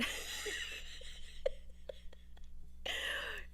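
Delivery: breathy silent giggle; whispered laugh. Real, not acted. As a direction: laugh under the breath at the joke. Laughing at the wine name.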